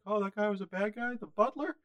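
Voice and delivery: high-pitched put-on disbelief; mock dismayed fan. Host playing a shocked viewer of the butler twist, high mock dismay.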